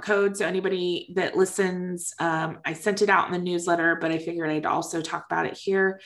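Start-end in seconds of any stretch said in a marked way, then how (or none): none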